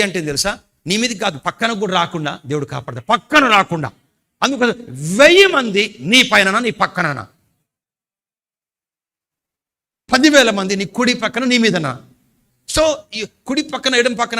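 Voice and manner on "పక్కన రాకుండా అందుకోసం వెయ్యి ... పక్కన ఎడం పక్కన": high, loud, impassioned sermon cry; preaching at full force. Acted. Pastor stirs the congregation to worship, loud impassioned preaching. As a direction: lift the hall with it. Preacher proclaiming psalm promise.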